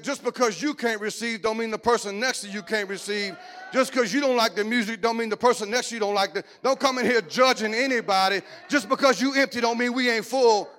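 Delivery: driving, emphatic preacher delivery; forceful preaching. Real, not acted. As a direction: preach it hard at the congregation.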